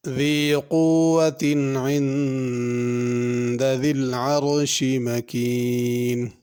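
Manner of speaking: slow, drawn-out chanted recitation; measured melodic tilawat; Quran recitation. Shown as a demonstration. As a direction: recite it like the teacher modelling tajweed. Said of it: "teacher recites verse to model tajweed".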